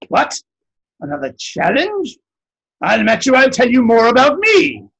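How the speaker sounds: indignant giant. Acted. Giant counters the wise one's kindness, loud and indignant. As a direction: bristle and top the offer.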